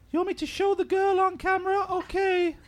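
high put-on girly voice; man doing a woman's voice. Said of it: "Can anyone do a really good girl's voice?".

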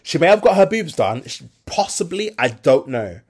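usual speaking voice

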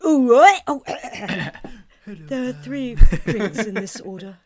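loud blokey pub voice, laughing; forcing a deep voice. Pretend. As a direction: order like a rough bloke. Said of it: "I go up to the bar and I go".

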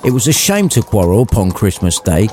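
ordinary delivery

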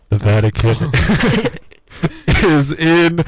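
loud, high, laughing yells; shouting. Pretend. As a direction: bellow the news as a joke. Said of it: "Why is being loud so funny?".

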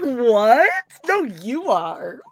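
high, drawn-out coy teasing; imitating a giddy flirt; mock flattered crush. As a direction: play a thrilled prom invitee, hammed-up. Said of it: "looks like he just asked her to prom".

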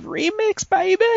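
high, gleeful exclamation; excited hype. Real, not acted. Podcast host announcing a game release, excited. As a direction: let it leap up high in celebration.

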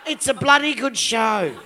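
ordinary talk

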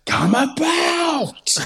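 high, drawn-out ship command call; mock sailor shout. Moving a table wrongly, mock sailor command shout. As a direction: bellow it like a captain at sea. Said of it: "you're like".

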